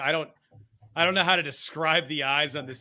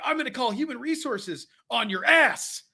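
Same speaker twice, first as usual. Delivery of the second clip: imitating a wrestler, high-pitched, "ass" punched hard at the end; odd stress on ASS; 9 semitones higher, 4 dB louder than usual. Fan mimicking Jericho's line, weird stress on "ass". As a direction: punch "ass" as the oddly emphasized last word. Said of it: "Why did you emphasize ass?".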